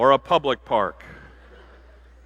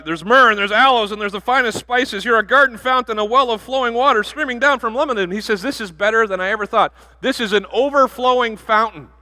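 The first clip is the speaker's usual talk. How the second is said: gushing groom; 8 semitones higher, 4 dB louder than usual. Acted. Groom gushing on wedding night.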